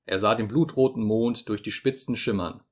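ordinary delivery, nothing marked

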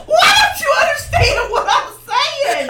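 exasperated high laughing yell; laughing shout. Real, not acted.

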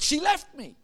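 high-pitched mock lament; imitated heartbroken man. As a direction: play up the jilted man's woe. Retold distraught man's revelation.

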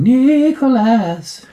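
high, very slow wailing ghost call; unearthly voice. Retold or imitated. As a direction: draw the name out like a ghost. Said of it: "her voice is like the ghost going".

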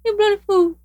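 very high-pitched imitation duck voice; talking duck impression. Shown as a demonstration. Host imitates the recorded talking duck for co-host, high duck voice. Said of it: "let me see if I can imitate".

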